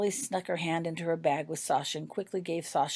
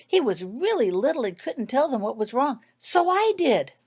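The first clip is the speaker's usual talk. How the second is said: excited; 9 semitones higher, 8 dB louder than usual. A narrator's reading. Excited child tells her cousin.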